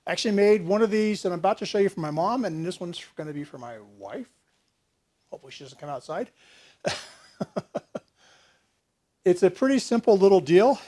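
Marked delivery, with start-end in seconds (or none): 2.5-6.3 hushed. Real, not acted. Hiding gift from wife.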